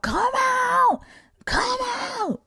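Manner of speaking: very high, drawn-out wail; mock exorcism cry. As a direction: imitate a frenzied deliverance chant. Missionaries beating niece, driving gayness out.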